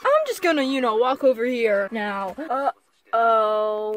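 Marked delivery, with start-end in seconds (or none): none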